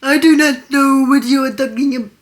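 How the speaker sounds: very loud, hammed-up Russian accent; overdone Russian accent. Shown as a demonstration. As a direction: boom it out like a strong Russian. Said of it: "went an octave too low".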